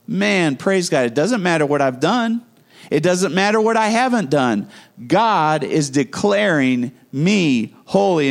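ordinary delivery

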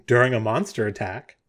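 normal talking voice

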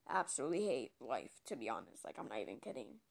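low, quiet muttered confession. Real, not acted. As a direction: drop it to a low mumble.

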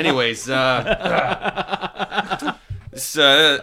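put-on old borscht belt comic. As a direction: play a cheesy eighties stand-up. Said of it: "a bad eighties comedian".

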